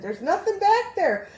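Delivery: high, loud, slowed reenacted protest; acting out a scared, emphatic denial; insistent shout. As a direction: shout it back, drawing it out. Scared retort to panicking friend.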